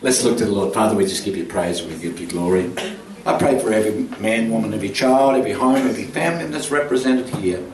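low, measured praying voice with pauses; earnest prayer. Real, not acted. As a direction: lead the congregation in prayer, softening into hushed worship. Leads a closing prayer.

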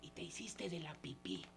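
very soft, whispered remark; hushed aside. Acted. Master to the fainted dog, hushed aside. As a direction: drop it to a confidential murmur.

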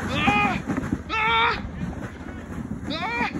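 high thrilled screams down the hill; excited screams. Real, not acted. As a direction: yelp with each bump. Speaker screaming while sledding fast.

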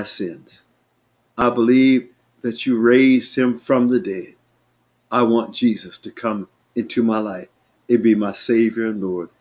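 low, measured prayer in short phrases; leading prayer. Real, not acted. Pastor leading listeners in salvation prayer, low measured praying. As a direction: pause for them to repeat after you.

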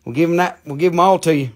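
high-pitched hometown Southern twang; North Carolina accent. Shown as a demonstration. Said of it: "in my hometown language here".

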